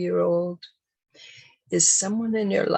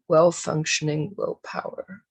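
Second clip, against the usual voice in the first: ordinary delivery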